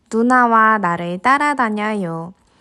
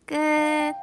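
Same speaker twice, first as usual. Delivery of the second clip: soft high drawn end; story ending; 5 semitones higher, 6 dB quieter, much slower than usual. Acted. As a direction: close the book gently.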